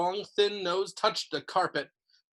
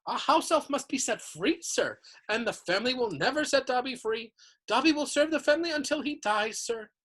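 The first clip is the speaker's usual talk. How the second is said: high-pitched reverent house elf; adoring house elf; 7 semitones higher than usual. A narrator's reading. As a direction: gush like a devoted little servant. Dobby explains servitude.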